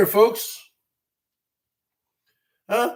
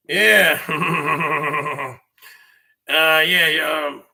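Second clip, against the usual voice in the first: Billy Bob Thornton impression; 6 semitones lower than usual. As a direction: sink way down into a mumbling backwoods Sling Blade voice. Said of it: "like Billy Bob Thornton from Sling Blade".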